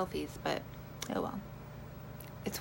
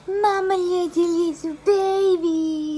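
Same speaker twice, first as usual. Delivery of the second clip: high, loud, slow cooing; 9 semitones higher, 10 dB louder, slower than usual. Real, not acted. Cooing at baby.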